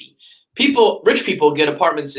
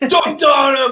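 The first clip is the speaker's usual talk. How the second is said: high, loud gloating yell; mocking crowd shout; 10 semitones higher, 4 dB louder, slower than usual. Crowd cheers a billionaire's insult, high loud shout. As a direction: whoop it like the crowd online. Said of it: "it would have been like".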